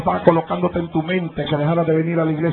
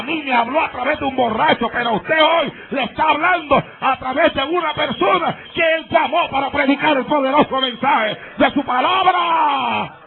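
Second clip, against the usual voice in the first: rising, fervent sermon shout; preaching; 7 semitones higher than usual. Acted. Pastor exhorts the congregation, higher fervent preaching. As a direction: raise the voice to the congregation.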